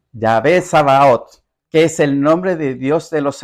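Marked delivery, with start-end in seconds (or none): 0.1-1.4 Yahvé Sabaoth said again, slowly and syllable by syllable; Yahvé Sabaoth repeated slowly so they catch it; slow repeat of Hebrew name. Shown as a demonstration. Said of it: "cómo se llama eso en hebreo".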